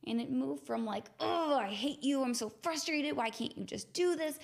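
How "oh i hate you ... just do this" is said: raised, higher, frustrated self-scolding; imitating harsh angry self-talk; angry inner voice. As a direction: snap at your own body in frustration. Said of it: "intense, angry talk".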